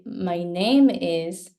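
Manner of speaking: "My name is" slowly, NAME strong, my and is weak; stress on NAME. Shown as a demonstration. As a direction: lean on NAME in "My name is". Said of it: "Name is usually more important".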